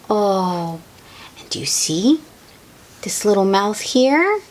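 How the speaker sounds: ordinary delivery, nothing marked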